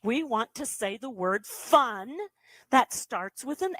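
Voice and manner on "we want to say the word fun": ordinary delivery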